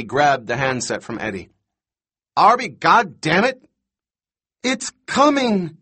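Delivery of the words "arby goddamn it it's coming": high, loud angry yell; furious shout. A narrator's reading. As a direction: blow up in a rage. Shouting, then Arby screams back.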